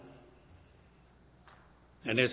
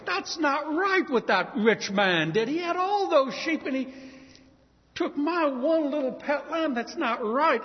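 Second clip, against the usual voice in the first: higher, pained protest; indignant; 9 semitones higher, 4 dB louder than usual. Acted. Poor man protesting the rich man's theft, indignant. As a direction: voice the injustice rising up.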